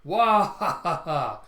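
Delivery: loud, slow, hearty acted laugh; big belly-laugh put on for a character; booming "wah ha ha", drawn out; genial laugh. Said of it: "genially smiling".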